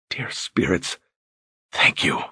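breathless whisper of relief. Acted. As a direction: whisper it, overwhelmed. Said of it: "he whispered".

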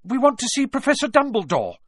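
high, loud, bold declaration; rather brave. A narrator's reading. Hermione tells the professor what they want, bravely. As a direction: speak up bravely to someone in authority. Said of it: "rather bravely".

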